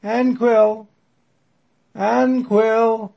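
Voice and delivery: long drawn-out call; stretching words. Acted. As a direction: stretch the name out, high and loud. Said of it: "calls out in a long, drawn-out voice".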